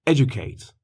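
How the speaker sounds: educate with the first syllable punched, ED-u-cate; stress on EDucate. Shown as a demonstration. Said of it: "l'accent tonique sur l'avant-avant-dernière syllabe". (accent tonique sur la première syllabe d'educate)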